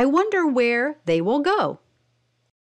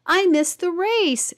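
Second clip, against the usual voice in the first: sniffly; 8 semitones higher than usual. Acted. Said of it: "Sea Turtle sniffed".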